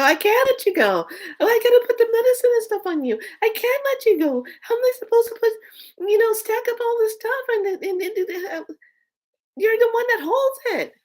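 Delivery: cling on desperately, words tumbling; frantic pleading. Retold or imitated. Refusing to let the shattered cabinet go, frantic and high-pitched.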